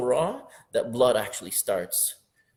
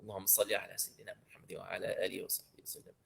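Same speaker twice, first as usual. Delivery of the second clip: soft, rapid murmured salawat; quiet blessing; 6 semitones lower, 12 dB quieter, much faster than usual. Shown as a demonstration. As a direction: murmur the salawat fast under the breath. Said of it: "second recitation, whispered".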